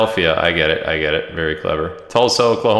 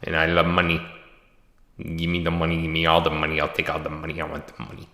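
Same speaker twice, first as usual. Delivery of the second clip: mock greedy movie quote; 3 semitones lower, 7 dB quieter, faster than usual. Streamer jokes to viewers about loving money, in mock greed. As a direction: lower and softer, dropping lower with each line, half-joking greed. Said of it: "I think it's from a movie".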